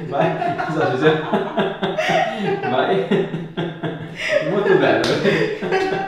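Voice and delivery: bursting into loud laughter through the words; can't stop laughing, words dragged out. Real, not acted. Osteopath cracking up at his patient's unexpected move, laughing loudly. Patient does something funny mid-test.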